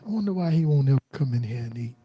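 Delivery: quick, soft gossip; imitating gossiping coworkers. Coworkers gossiping about him over barbecue, quick and soft. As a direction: rattle it off quietly behind his back. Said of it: "they talk about me".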